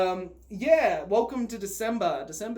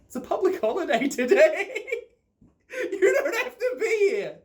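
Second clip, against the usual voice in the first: higher voice, laughing through the words; laughing teacher; 12 semitones higher, 4 dB louder than usual. Retold or imitated. Teacher telling the kid it's a holiday, laughing. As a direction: chuckle it out like an amused teacher.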